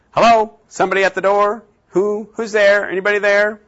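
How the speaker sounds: high, loud call toward the door. Retold or imitated. Calling out again to visitor.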